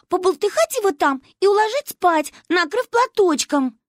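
put-on high, loud, gushing girl; carried-away child. A narrator's reading. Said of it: "Манюню несло".

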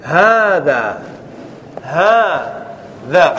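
very slow, stretched "هذا", haaa-dhaaa; showing the prefix ha. Shown as a demonstration. Teacher pointing out the prefix to students, very slow. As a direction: pull "هذا" apart so the opening ha stands out. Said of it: "لاحظ هاء التنبيه".

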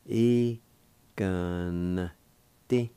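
E, gun, ti split into separate beats; syllable by syllable. Shown as a demonstration. Teacher breaking the word into syllables. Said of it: "pronounce the N with the G V like this".

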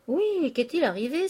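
exclamation haute et plus forte; s'écrie. Acted. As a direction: lancez la question d'un coup, alarmé. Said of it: "S'écria Eugène". (s'écrier avec inquiétude)

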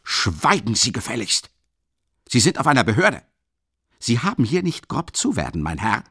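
enraged lieutenant ordering the student to be silent; furious command. A narrator's reading. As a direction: slam down your authority. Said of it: "Er zischte nur und sprang".

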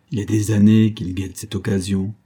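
ordinary delivery, nothing marked

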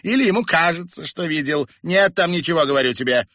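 loud, high irritated retort; cross and baffled. A narrator's reading. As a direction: brush him off crossly. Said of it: "расхохоталась сердито и озадаченно".

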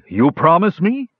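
high-pitched eager question; eager dragon. A narrator's reading.

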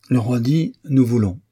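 mock him with a royal phrase; ironic. A narrator's reading. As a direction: ironic jab said in French. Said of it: "avec un accent ironique".

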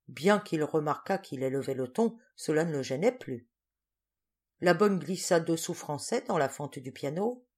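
ordinary delivery, nothing marked

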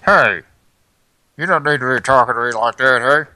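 loud scolding, fed up; angry. Acted. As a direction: tell him off sharply. Yells back at rude caller.

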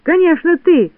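high, loud retort; accusing shout. A narrator's reading. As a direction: throw the blame back loudly. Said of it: "закричали тут все".

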